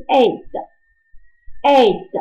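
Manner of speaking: "eight" said slowly with a small mouth; slow, careful "eight"; small-mouth vowel. Shown as a demonstration. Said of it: "它是小嘴巴".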